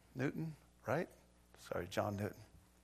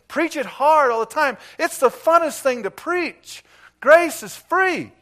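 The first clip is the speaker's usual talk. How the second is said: higher and louder, full-force preaching; fiery preaching; 11 semitones higher, 9 dB louder than usual. Real, not acted. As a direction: preach it with fire.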